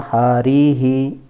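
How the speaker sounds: हरी ही chanted loud with a long ree and echoed hee; loud, drawn-out हरी ही; visarga pronunciation. Shown as a demonstration. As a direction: stretch हरी ही on a level note. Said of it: "जैसे यहाँ पर था".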